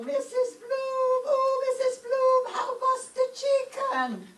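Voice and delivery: high, loud call to the neighbor across the way; a bright neighborly holler. Acted. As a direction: sing out the name so she hears you across the courtyard. Calling across to neighbor Mrs Bloom.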